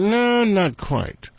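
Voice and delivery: put-on high, drawn-out English accent; British agent. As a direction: stretch it out in an imitated English accent. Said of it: "he's a British guy".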